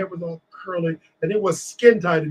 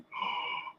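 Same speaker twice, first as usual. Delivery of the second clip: sharp, quiet intake of breath; awed gasp; 13 dB quieter than usual. Retold or imitated. Newcomer lands at the TV network, awed gasp. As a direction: gasp as if amazed. Retells amazement at landing the job.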